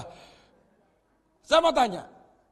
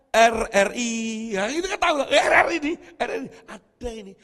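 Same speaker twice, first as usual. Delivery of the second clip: mock radio find; 8 dB louder, faster than usual. Mimics radio tuned in.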